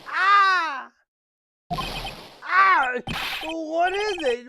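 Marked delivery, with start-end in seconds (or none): 0.1-2.9 loud high shriek of shock; sudden shocked cry; startled scream. Acted. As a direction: scream out in fright. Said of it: "Why did you scream like that?".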